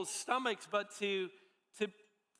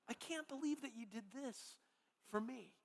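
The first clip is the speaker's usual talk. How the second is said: softer, almost under the breath; hushed repeat; 2 semitones higher, 9 dB quieter than usual. Retold or imitated. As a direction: let the repeat sink down, moved. Sandy repeats her thanks, softer.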